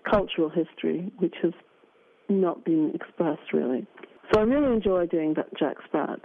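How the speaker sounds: ordinary delivery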